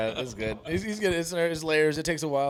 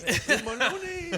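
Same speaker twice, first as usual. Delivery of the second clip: slow, higher, laughing imitation; laughing mock yell; 11 semitones higher, 4 dB quieter, much slower than usual. Recalling Freddie yelling at the teacher, laughing mock yell. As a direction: drag out Miss Maloney while laughing. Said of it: "he yelled it just like that".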